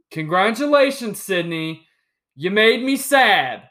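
playful loud protest at his fiancée Sydney; mock outraged shout. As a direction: call her out in hammed-up outrage.

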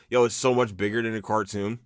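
ordinary delivery

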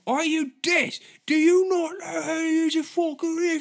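attempted Ramsay rant; Gordon Ramsay. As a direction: bark like a furious TV chef. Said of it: "I don't know how to do his voice".

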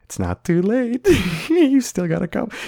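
high, coaxing little voice; talking body part. Acted. Body part urging its owner on, high coaxing voice. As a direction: draw it out high, egging him on. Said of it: "my pee-pee's like".

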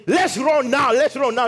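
high, loud, hurried-sounding exclamation; mock urgency. Preacher mimics what Jesus didn't say, mock urgency. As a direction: act out a panicked call to run.

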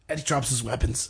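usual speaking voice

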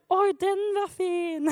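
slow, drawn-out cooing over a find; hammed-up dreamy admiration; mock delight. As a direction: play a kid slowly admiring a treasure. Acts David admiring a found stone.

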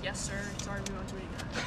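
sheepish apology. Retold or imitated. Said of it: "we're gonna be like".

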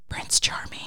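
unvoiced sighed aside; whisper. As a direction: imitate a sly whispered comment to her. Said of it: "and he whispers".

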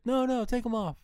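high, insistent imitation; retold insistence.

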